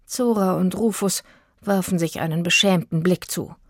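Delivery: usual speaking voice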